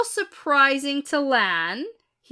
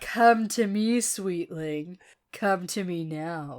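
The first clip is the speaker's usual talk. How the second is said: Edain voice; 4 semitones lower, 3 dB quieter, slower than usual. Acted. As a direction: purr it like an older noblewoman. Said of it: "that's my Edain voice".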